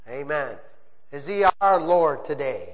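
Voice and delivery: ordinary talk, nothing marked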